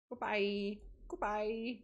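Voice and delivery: ordinary delivery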